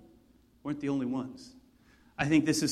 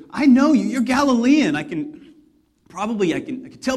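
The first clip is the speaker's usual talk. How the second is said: higher, louder, sure of himself; loud accusation; 6 semitones higher, 9 dB louder than usual. Retold or imitated. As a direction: call him out in front of everyone. Bystander accuses Peter.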